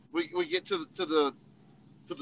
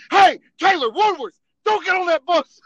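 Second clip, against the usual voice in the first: loud high shout across a lot; calling out; 10 semitones higher, 11 dB louder than usual. Retold or imitated. Imagined sergeant yelling to stop the soldiers boarding the bus. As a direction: yell at them to stop.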